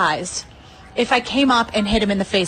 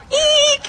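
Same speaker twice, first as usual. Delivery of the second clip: gleeful squeal; 4 dB louder than usual. Retold or imitated. Gleeful squeal after taunting.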